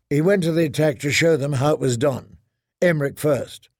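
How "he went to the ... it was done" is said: ordinary delivery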